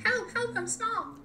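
squeaky high little cry for help; a tiny creature calling for help; tiny voice sound effect. Pretend. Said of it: "I made another sound effect".

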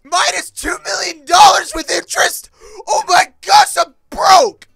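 very high, loud wail; shrieking despair, played for comedy; horrified. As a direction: scream it in hammed-up financial panic. Discovers he is broke.

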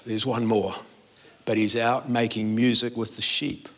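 low, soft sheepish admission with a nervous laugh; a hint of an embarrassed father owning up; awkward Jesse. Embarrassed Jesse admits another son.

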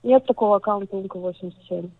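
ordinary talk

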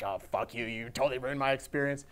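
low, resentful muttering voice; a hint of a sulky client muttering; inner grumble. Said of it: "inside he's just like".

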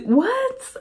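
retold shocked what; shocked disbelief. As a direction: squeak it out in disbelief. Everybody shocked at the throw.

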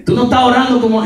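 high, quick nagging; imitating scolding wife. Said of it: "Carlos, yo te voy a hablar".

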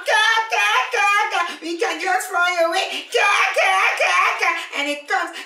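high, loud, cackling gull voice that jeers; mocking gulls. Gulls jeer at Paddy from the sky, squawking high. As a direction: caw and taunt like a cheeky seabird. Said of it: "mocking him".

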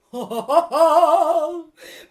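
loud, high villain cackle; big bad wolf's wicked laugh; evil wolf laugh. Wolf laughs before speaking.

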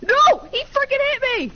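high, loud angry yell; outraged shout. Real, not acted. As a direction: scream it out in outrage. Outraged at being shot.

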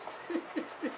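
airy high-pitched giggle; breathy high laugh. Real, not acted. Laughing at the show.

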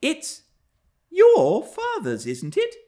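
put-on high-pitched voice of a curious girl; inquiring young girl. A narrator's reading. Young Cathy turning to Hareton with a question, high girlish voice. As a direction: play a little girl, pitched well up.

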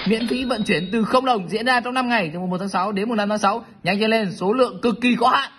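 giọng quảng cáo cao, dồn dập; hô hào như quảng cáo khuyến mãi; đọc quảng cáo. Acted. Đọc quảng cáo nhà tài trợ. (giọng đọc quảng cáo sôi nổi)

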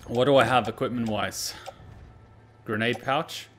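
usual speaking voice

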